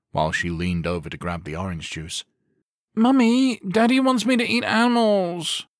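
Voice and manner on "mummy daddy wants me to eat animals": put-on high, loud childish whine; whiny little girl. A narrator's reading. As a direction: whine like a ten-year-old tattling to mum. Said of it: "Samantha whined".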